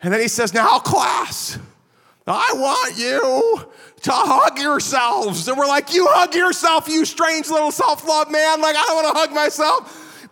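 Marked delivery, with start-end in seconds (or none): 0.5-5.5 mock squeaky, high-pitched little lecturer; high squeaky professor. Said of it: "he had this high squeaky voice". 5.9-10.3 high-pitched mock outrage from freshmen; indignant protest. Students' mock protest at the professor.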